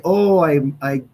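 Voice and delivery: mock official apologizing; mock apology. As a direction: sneer it like a fake apology. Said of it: "You don't get to say".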